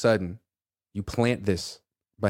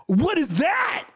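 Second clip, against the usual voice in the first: much higher, slow, hammed-up gasp of wonder; mock amazement; much slower than usual. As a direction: play dumb like you just found treasure. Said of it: "and you're like".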